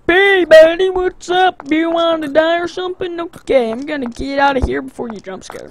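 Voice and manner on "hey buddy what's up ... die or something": mock tough guy. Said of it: "I'm just gonna be like".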